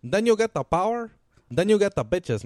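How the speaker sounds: high, mock Tony Montana voice; high Tony impression. Quoting Scarface's famous line.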